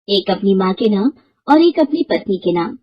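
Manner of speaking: usual speaking voice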